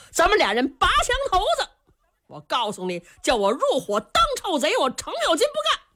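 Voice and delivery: angry refusal. Acted. 程咬金 refuses angrily.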